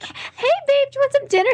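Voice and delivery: put-on high, cooing baby talk to a husband. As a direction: talk to him like a toddler. Why are you talking like that?.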